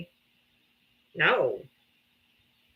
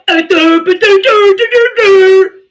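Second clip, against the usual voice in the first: loud, high, slowed mock stammer; mock stutter; 9 semitones higher, 13 dB louder, slower than usual. Showing what a stutter sounds like, loud mock stammer. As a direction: stammer on purpose to show it. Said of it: "by me going".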